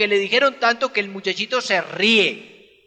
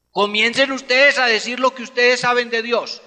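normal talking voice

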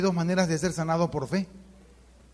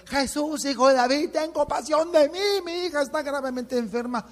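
high calling out to Jesus; crying out; 9 semitones higher than usual. Acted. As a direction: plead as if shouting across a crowd. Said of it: "se puso a gritar". (mujer cananea clamando a Jesús, suplicante)

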